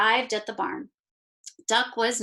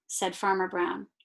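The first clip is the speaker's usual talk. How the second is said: ordinary delivery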